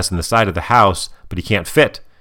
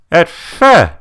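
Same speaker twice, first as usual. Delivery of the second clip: high, slow, loud mock mumble; garbled high-pitched mom voice; mumbling mom; 10 semitones higher, 6 dB louder than usual. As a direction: imitate a mom talking through a face mask. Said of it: "she's kind of mumbling".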